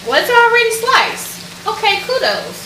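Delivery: high, surprised realisation; a bright little surprised aside; pleased surprise. Real, not acted. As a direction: react like you just noticed it's done. Surprised the cheese is sliced.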